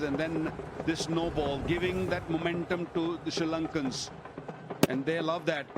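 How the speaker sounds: fired up about sloppy bowling; heated commentary. Real, not acted. Pundit criticises the no-ball. (worked-up commentator)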